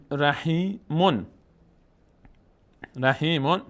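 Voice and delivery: رحیمون slowly, split as ra-hī, then "mon"; tanween "on" sounded. Shown as a demonstration. Teacher gives a second tanween example, رحیمون slowly with final "-on". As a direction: stretch "hī" in رحیمون, land on "mon". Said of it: "تنوین اون بود".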